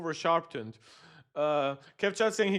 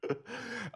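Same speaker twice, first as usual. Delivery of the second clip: disbelieving laugh; 6 semitones higher, 15 dB quieter than usual. Real, not acted. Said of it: "there is no excuse for this".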